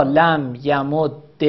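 یمد very slowly as ya-mud-de; doubled d in یمد, ending on e; لم یمد, drawn out with final kasra; merged with kasra. Shown as a demonstration.

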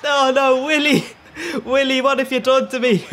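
high, loud anguished yell cracking into laughter; shouting. Retold or imitated. Yelling at his keeper Willy over the conceded goal, loud laughing wail. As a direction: wail it at the screen in despair. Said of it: "my neighbours are wondering why I'm shouting".

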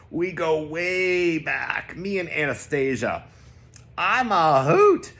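slow, high, put-on showman voice; a lightly hammed-up charmer voice; hammy joker. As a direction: ham it up for the crowd. Hamming up for nurses.

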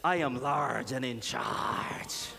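deep, slow, solemn proclamation; God. Acted. Preacher voicing the Father to congregation, deep and solemn. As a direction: intone it low and very slowly, with authority. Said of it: "What God is trying to say is".

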